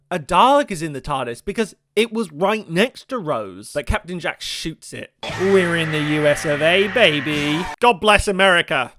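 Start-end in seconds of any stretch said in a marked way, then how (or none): 5.4-8.9 loud, hammed-up American accent; mock American. Cheering Captain Jack's shot, loud mock American patriot. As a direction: holler it like a patriotic American caricature.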